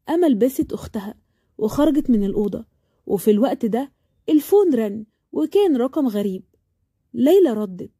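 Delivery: ordinary delivery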